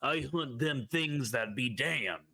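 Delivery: Western Hulk Hogan. Acted. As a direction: play a cowboy wrestler, stretching key words. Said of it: "a Western Hulk Hogan".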